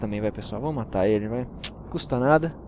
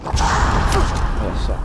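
drawn-out unvoiced whisper; slow whisper; 5 semitones lower, 5 dB louder, much slower than usual. Real, not acted. Gamer lining up a sneaky shot, slow whisper. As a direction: breathe it while aiming.

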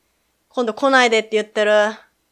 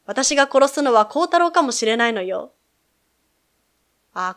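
ordinary talk, nothing marked

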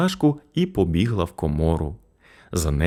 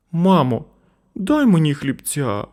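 put-on high child's voice asking; boy asking mother for bread; pleading boy; 9 semitones higher, 4 dB louder than usual. A narrator's reading. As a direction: ask like a hungry little boy. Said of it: "раптом попросив Сашко".

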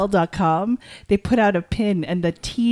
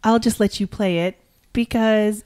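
ordinary delivery

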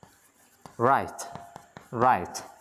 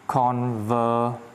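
"conversation" drawn out very slowly; syllable by syllable; much slower than usual. Shown as a demonstration. Teacher showing students the word's sounds, syllable by syllable.

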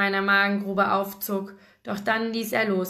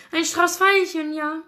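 high, louder playful grin; grinning; 8 semitones higher, 4 dB louder than usual. A narrator's reading. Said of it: "grinste Jack".

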